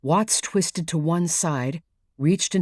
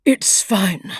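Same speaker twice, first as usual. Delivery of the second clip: strained whisper through clenched teeth; through gritted teeth; 3 semitones higher, 5 dB louder than usual. Acted. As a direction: force it out past clenched teeth. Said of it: "said Sharp through gritted teeth".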